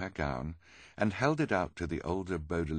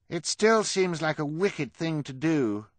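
high, loud character voice; put-on high voice; 11 semitones higher, 6 dB louder than usual. A narrator's reading. Klaus in fake high voice.

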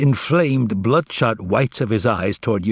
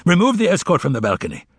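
higher, fast barked command; shouting order; 7 semitones higher, faster than usual. A narrator's reading. Pilate orders the escort off the balcony, shouting fast. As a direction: snap the order at the guards. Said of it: "Pilate then began shouting".

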